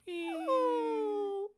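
a very high, long falling squeal; falling scream. Retold or imitated. Podcaster mimicking Wendy's fall with a high squeal. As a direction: squeal like someone dropping off a plank. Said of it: "she falls in here like".

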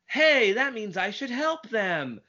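lightly imitating a high whiny kid; a hint of Chucky's nasal whine; Chucky from Rugrats voice. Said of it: "sounds exactly like Chucky from Rugrats".

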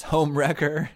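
loud accusing yell. Retold or imitated. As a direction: shout it like an accusation. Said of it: "and he yells".

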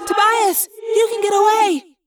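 high, loud urgent call; high-pitched, pleading shout; Cassie calling out. A narrator's reading. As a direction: call up to someone far away. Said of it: "Cassie called Tobias".

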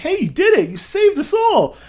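high, loud burst of mock celebration; retold excited cheer. Acted cheer on meeting her.

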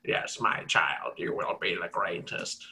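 hammed-up voice blessing a child, pitched well down; Valaketh. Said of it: "Meanwhile, Valaketh is just like".

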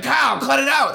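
high shouted scolding; retold shout. A friend shouting at the comic to cut it out. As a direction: yell it to stop him.

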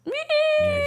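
very high, loud mock squeal; upset child's wail. Dad imitating his child sent downstairs, mock upset wail. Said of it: "he got really upset".

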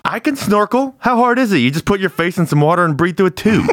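high, excited boasting; eager volunteering. Acted. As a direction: jump at the chance.

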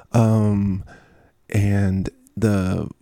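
usual speaking voice

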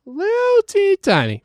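very high little squeak; high-pitched baby-talk echo; tiny cutesy voice. Pretend. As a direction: coo over something tiny. Cooing over kitten on card.

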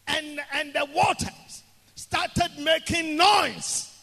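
loud, high sermon storytelling; full-force preaching. Real, not acted. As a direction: tell the Bible story at a preacher's shout.

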